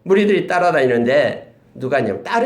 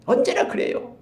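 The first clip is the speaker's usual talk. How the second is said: usual speaking voice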